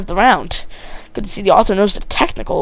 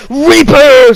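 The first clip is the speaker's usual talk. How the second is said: loud, high shout with a falling end; drawn-out angry-soldier yell; 6 semitones higher, 7 dB louder than usual. A narrator's reading. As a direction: yell the name across the battlefield. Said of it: "Soldier seventy-six yelled".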